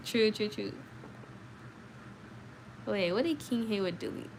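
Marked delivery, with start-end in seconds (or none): none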